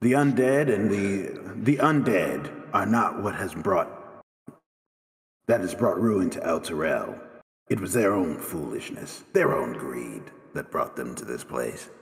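low, grave in-character pronouncement; stern judge. Acted. Game master as Maggie blaming the city's own greed, stern judge.

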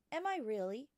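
put-on a bright young girl asking her teacher; young Matilda. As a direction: ask like a curious small girl. Matilda's childlike voice.

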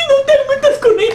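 high, laughing mock voice; squeaky laughing imitation; mocking defender. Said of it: "el defensor de las mujeres".

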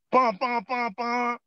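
high-pitched honking imitation; imitated car horn. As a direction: mimic a horn in short high beeps. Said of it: "next thing I hear".

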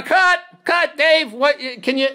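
high, loud exasperated call; calling cut. Retold or imitated. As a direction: holler a halt across the set. Crew calling cut at him.

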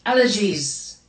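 allergies with the mic effect on the voice; fun vocal effect. Real, not acted. On a live stream, the effect catching her voice by surprise. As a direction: let the effect colour the word allergies. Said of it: "that was a fun vocal effect".

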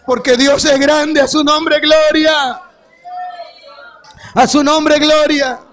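slow, rising proclamation of glory; preaching. Acted. Telling the congregation what to say to neighbours, proclaiming. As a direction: proclaim it for all to repeat.

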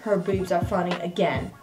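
low, soft muttered repeat; low soft grumbling aside. Pretend. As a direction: mumble it under your breath. Mock mumble of old comment.

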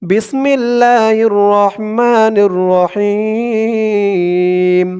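measured Quranic recitation, slow and drawn; slow devotional Arabic recitation. Shown as a demonstration. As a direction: recite it slowly and reverently. Recites opening formula in Arabic.

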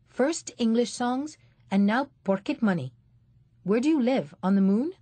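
mocking. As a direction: sneer at the boy's manners. Man mocking the boy.

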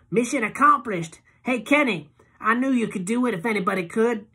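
high, loud tipsy woman; gushing, a little too loud from drink; high drunk woman cheering Kenny; Loretta tipsy. A narrator's reading.

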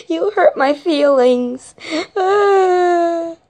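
high slow wounded wail. Acted. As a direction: cry it out dramatically. Victim wails.

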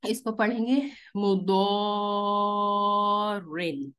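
मुदोरिन recited very slowly with the long vowel held; Quranic recitation. Shown as a demonstration. Teacher demonstrates recitation of the word for the class, slow. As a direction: slowly chant the word, stretching the madd. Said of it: "तो इसी तरह से".

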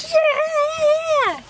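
very high, loud drawn-out whoop; celebratory yell. Retold or imitated. Fans just walked out of the restaurant, celebratory yell. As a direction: let the held-back excitement burst out. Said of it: "we're like".

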